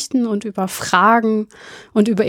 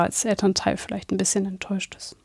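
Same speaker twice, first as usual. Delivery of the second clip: whispering; 9 dB quieter than usual. Pretend. Repeats it quietly for sleeping kids.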